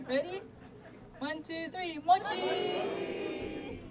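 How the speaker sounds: high, bright countdown call to the crowd; calling out for the photo. Real, not acted. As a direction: count them in and call out mochi. Group selfie countdown, calling out.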